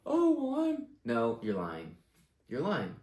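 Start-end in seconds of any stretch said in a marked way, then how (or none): 0.1-0.9 squeaky flustered voice. Pretend. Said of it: "I want them to say".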